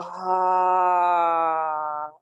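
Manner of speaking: big open-mouthed release sigh; dramatic sigh. Shown as a demonstration. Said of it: "open your mouth and just big sigh".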